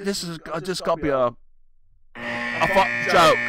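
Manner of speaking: imitating an exasperated complaint, dragged out; mock outrage. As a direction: stretch it out in mock disgust. Acting out the man's outraged quote.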